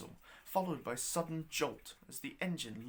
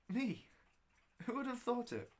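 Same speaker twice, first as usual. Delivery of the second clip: higher, wondering disbelief; amazed disbelief; 9 semitones higher than usual. Acted. Traveller amazed that Lady Evelyn awaits him, higher wonder. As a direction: marvel at being chosen.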